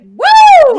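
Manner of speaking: very high, loud "woo"; excited whoop. Retold or imitated. Fan seeing Wes walk into the diner, excited whoop. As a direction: let out a piercing, thrilled whoop. Re-enacts swooning over sexy actor.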